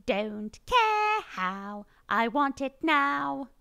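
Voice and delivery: high, drawn-out bratty British demand; Veruca Salt. Shown as a demonstration. Streamer showing a style of British voice, spoiled slow demand. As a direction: stretch each word into a spoiled English whine. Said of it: "The Veruca Salt style voices".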